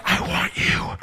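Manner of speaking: slow whispered request. Retold or imitated. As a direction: whisper it slowly. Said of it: "I was waiting for her to be like".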